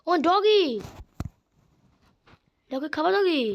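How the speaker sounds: high, loud sing-song call to a dog. Real, not acted. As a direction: coax the dog over, voice up high. Calls to a dog.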